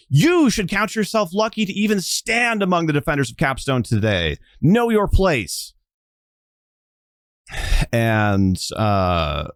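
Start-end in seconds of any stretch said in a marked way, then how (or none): 0.1-5.7 stern rebuke. Acted. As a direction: put her in her place, cold and high. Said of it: "and is like".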